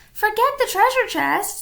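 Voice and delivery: high, loud urgent plea; Annie. A narrator's reading. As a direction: call it firmly to Jack. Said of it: "said Annie".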